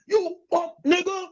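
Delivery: high, sneering imitated insult; mock wife insult. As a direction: spit it out like the angry wife. Wife's insult on recording.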